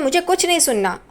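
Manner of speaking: angry cut-off. Retold or imitated. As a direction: shut her down sharply. Angry aunt cutting her off.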